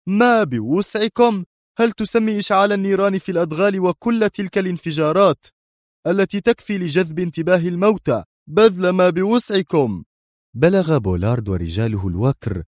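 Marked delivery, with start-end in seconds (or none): 0.1-1.4 slow, high, incredulous echo; angry. A narrator's reading. Boss throws back his subordinate's excuse, slowly and angrily. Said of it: "قال الصوت في غضب". 1.8-10.0 high-pitched, indignant tirade; angry. A narrator's reading. Boss berates his subordinate over the explosions, high and angry. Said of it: "قال الصوت في غضب".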